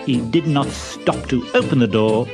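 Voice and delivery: ordinary delivery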